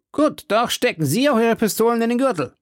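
high-pitched wary demand; high gentleman's voice; English gentleman. A narrator's reading. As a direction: insist on equal terms, pitch well up.

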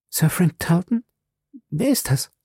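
high, questioning woman's voice on "Sir Frank Tarleton"; puzzled young woman. Acted. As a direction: echo the name in confusion. Violet fragt den Namen zurück. (verwunderte junge Frau fragt hoch nach)

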